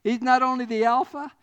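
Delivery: loud, rising sermon climax; preaching at full force. Acted. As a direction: drive the point home to the congregation. Preaching the middle point at full force.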